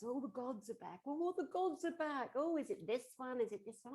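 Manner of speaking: loud, high, gushing fuss; hammed-up thrill, mocking the fans; mock excitement. Gossips hail the gods' return, loud mock excitement.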